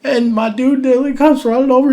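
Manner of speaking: usual speaking voice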